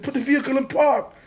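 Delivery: high, loud retold command; shouted order. Retelling the arrest in the garage, high shouted command. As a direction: imitate yelling over a police raid.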